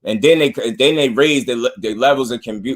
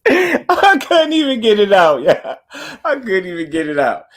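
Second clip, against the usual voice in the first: high, helpless real laughing through the words; tongue-tied laughter; 8 semitones higher, 3 dB louder than usual. Real, not acted. Host can't get his point out, laughing hard. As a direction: squeal it out while cracking up. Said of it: "I'm tongue-tied, man".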